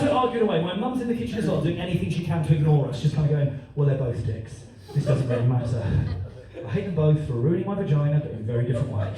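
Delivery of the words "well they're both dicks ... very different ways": low, deadpan aside; resigned mum. Acted. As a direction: comment on the row low, to yourself. Mum mutters while ignoring the row.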